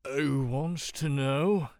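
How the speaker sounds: loud, slow slurred challenge; boozy drawl, loud and dragged out; drunken slur. A narrator's reading. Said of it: "The man slurs".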